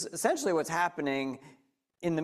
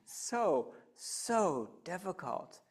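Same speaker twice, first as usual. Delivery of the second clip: drawn out; 2 semitones higher, 5 dB quieter, much slower than usual. Real, not acted. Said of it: "that's so simple".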